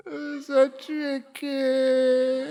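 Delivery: high, drawn-out comic wail; hammed-up crying. Wife breaks down over the argument, mock crying. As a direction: wail it slowly, dissolving into mock sobs. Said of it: "then she started to cry".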